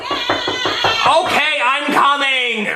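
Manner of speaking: dad interrupted by daughter, angry yell; annoyed shouting. Acted. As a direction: snap back at the door, pitch high.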